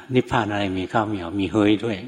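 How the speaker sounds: ordinary delivery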